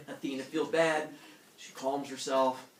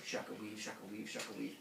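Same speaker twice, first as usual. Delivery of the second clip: much softer, low hushed chant; sneaky weaving murmur; 5 semitones lower, 12 dB quieter, faster than usual. Retold or imitated. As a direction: keep it down while peeking.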